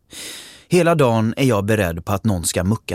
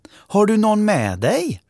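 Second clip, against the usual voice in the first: much higher, louder asking voice; woman's voice; 13 semitones higher, 3 dB louder than usual. Acted. Mother asks her daughter if she brought someone, bright and high. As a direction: ask brightly who came along. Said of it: "frågar en kvinnoröst".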